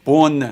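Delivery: بون with an oral o and a full n; French "bonne", no nasal vowel in بون; non-nasal vowel demo. Shown as a demonstration. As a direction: say بون loudly, vowel out of the nose. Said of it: "دیگه توی بینی نیست".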